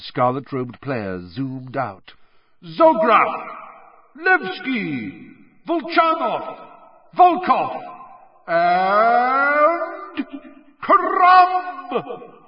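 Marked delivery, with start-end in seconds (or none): 2.6-12.3 high, loud, drawn-out announcer call; stadium announcer. A narrator's reading. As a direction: announce the team to the whole stadium. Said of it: "yelled Bagman".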